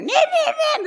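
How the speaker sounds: high, loud giggly squeals mimicking the girls; girls chattering over each other. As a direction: squeal it like noisy daughters, with a laugh. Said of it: "they're talking over the top of each other".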